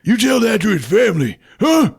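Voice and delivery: high, loud tough-cop yell; mock shout. Cobra shoving the reporter, high mock shout. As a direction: yell it in his face.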